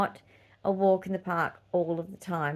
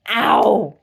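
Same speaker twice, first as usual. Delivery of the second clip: mock cry of pain; 12 dB louder than usual. Recalling childbirth pain.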